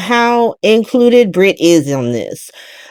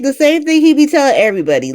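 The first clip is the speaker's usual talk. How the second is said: ordinary talk, nothing marked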